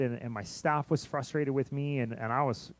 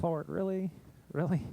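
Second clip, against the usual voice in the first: higher, pleading "really?" thrown up to God; exasperated disbelief; 6 semitones higher than usual. Retold or imitated. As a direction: complain to the Lord in disbelief.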